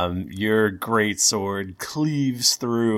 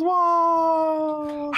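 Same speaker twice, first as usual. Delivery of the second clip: cheering crowd. Acted.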